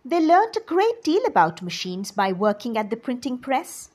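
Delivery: plain reading voice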